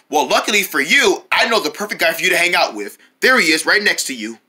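usual speaking voice

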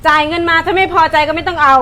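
loud, scolding rant; angry lecture. Acted. Shop owner ranting at a customer who asked for a discount, loud scolding.

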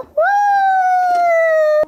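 very high loud whoop; thrilled whoop. Acted. Riding the carousel.

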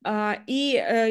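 normal talking voice

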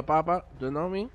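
usual speaking voice

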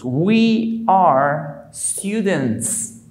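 We are students, drawn out word by word; very slow model. Shown as a demonstration. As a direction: stretch each word for learners. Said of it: "Se diría nosotros". (muy lento, palabra por palabra)

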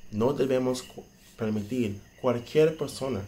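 ordinary talk

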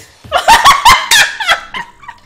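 a loud, high, wild burst of laughter; loud high cackle. Real, not acted. Big laugh at leaving teammate.

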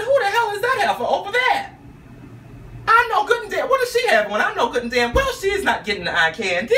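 throwing shade at the rival outfit; sassy indignation. Retold or imitated. Mocking the woman across the way, high and sassy. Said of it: "I said".

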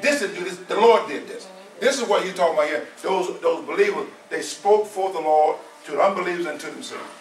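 usual speaking voice

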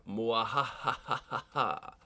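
long, slow villain cackle; hammed-up evil mastermind laugh; mock villainous "mwahaha", stretched out. Mock villain gloats over evil base.

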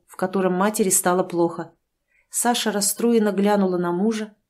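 usual speaking voice

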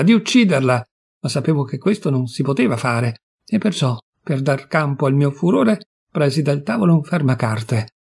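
plain reading voice

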